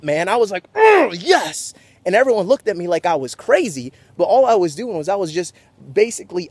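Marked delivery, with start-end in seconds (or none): 0.7-1.7 high growl into a pumped yes; fired up. Retold or imitated. Player just checked hard in the ribs, fired up. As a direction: roar it like an athlete psyching up. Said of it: "I just got fired up".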